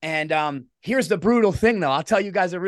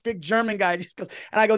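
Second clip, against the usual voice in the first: ordinary delivery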